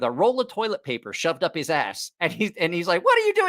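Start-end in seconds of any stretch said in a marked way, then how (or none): 3.0-3.5 high, startled what-are-you-doing; shocked bartender. Retold or imitated. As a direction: bartender barges in on him, pitched way up. Bartender opens bathroom door.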